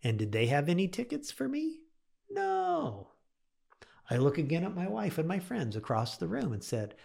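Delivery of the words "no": very high, comic "no"; mock dismay. Storyteller tells audience there were no tickets, mock dismay. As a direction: answer your own question in a high mock wail.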